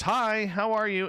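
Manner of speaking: put-on high, excited little boy saying hello; child's greeting. Son saying hello to mother's friend on the phone, high, lively child's voice. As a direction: pipe up brightly like a small son on the phone. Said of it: "let our friend hear your voice".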